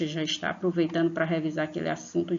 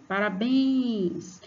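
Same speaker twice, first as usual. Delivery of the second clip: "parabéns" called out, "béns" high and stretched; calling-out syllable stress; 5 semitones higher, much slower than usual. Shown as a demonstration. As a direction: call "parabéns" to someone, leaning long on "béns". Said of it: "como se você estivesse chamando".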